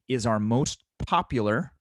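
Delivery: rising tune on "most popular"; upward inflection. Shown as a demonstration. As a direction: pitch rises at the end on POPULAR. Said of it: "with an upward inflection".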